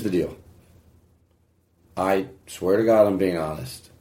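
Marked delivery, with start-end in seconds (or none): 2.0-3.9 slow, halting, choked-up confession; a real catch in the voice, lower and slower; getting emotional. Real, not acted. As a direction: hold back real emotion as you speak. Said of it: "I'm getting a little bit emotional".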